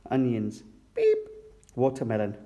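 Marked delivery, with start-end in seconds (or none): 0.9-1.2 very high, short beep; high electronic-style blip; scanner beep. A narrator's reading. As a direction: imitate a checkout scanner's beep. Imitating the checkout scanner.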